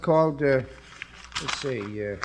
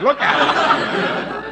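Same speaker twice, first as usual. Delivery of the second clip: laughing; 9 semitones higher, much slower than usual. Acted. Husband jokes about his bald head.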